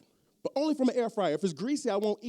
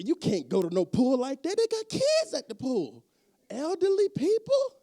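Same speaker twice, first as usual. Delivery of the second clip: mock scandalized onlooker; 8 semitones higher, 6 dB louder than usual. Poolside critic scolding a shirtless fat man, mock appalled. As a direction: act horrified on behalf of the kids. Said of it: "They say".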